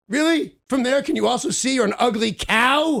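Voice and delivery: rising mock-polite question then a sneering insult; sarcastic, cutting retort; snide comeback to a stranger; sarcastic jab. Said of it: "And I said".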